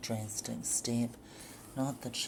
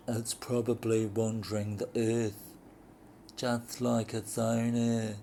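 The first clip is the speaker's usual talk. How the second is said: lightly voiced man, somewhat louder; dry male retort; 5 dB louder than usual. Husband mocking his wife about her lost luggage. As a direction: cut her down with a sneer.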